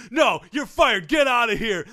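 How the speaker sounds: loud, high mock shout; angry boss. Boss ordering the coach out in a skit, loud and angry.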